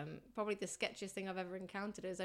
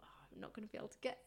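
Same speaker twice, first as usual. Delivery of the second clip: whispered thought; 9 dB quieter, much faster than usual. Retold or imitated. Retold worried thought while flying.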